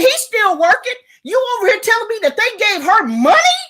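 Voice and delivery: high, loud furious rant; hyped rant. Retold or imitated. Ranting to Michelle about no birthday present, furious. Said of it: "I got hyped".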